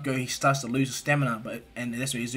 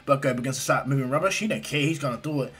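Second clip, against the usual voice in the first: usual speaking voice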